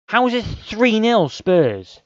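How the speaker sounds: high, slow, disbelieving cry; can't believe the score; real incredulous outcry. Real, not acted.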